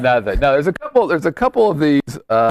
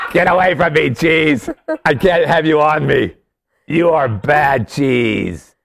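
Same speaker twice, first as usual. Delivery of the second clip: put-on French accent. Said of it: "That was my French accent".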